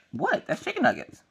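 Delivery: very high mock surprise; feigned innocence. Mom feigning innocence about the meat, high and surprised. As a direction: squeak it as if baffled by the doubt.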